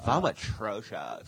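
put-on slow drawn-out valley girl accent. Valley girl accent mocking [name]'s snobby younger self. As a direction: drag out vom atrocious like a valley girl. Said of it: "he's got a Valley Girl accent now".

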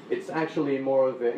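ordinary talk, nothing marked

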